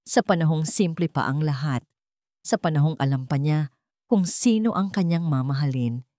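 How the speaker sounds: plain reading voice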